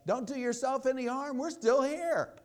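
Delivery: loud, high-pitched yell across a prison; acted shout of warning; urgent yell. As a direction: call out to stop someone, full voice. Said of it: "he yelled out".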